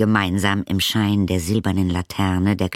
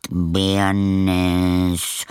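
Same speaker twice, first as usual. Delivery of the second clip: murmuring; 4 dB louder, much slower than usual. Acted. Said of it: "murmelte er".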